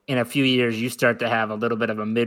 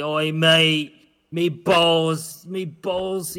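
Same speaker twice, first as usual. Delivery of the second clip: mock British lout, pitched up; stupid British accent; 3 semitones higher, much slower than usual. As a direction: do a dopey, drawn-out British "mate" voice. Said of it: "you know how stupid some British people sound".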